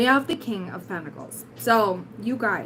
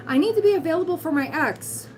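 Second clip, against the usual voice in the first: mocking; 8 semitones higher than usual. Mocking a clingy viewer.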